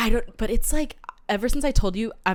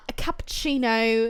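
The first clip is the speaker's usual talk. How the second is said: cappuccino in a mock British accent; affected British café order; put-on British accent; 2 semitones higher, slower than usual. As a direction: finish the order in fake British. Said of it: "slip into this accent".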